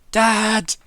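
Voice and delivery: high, loud shout upstairs; really loud. Acted. Shopkeeper shouting upstairs for her dad, really loud. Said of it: "really loudly".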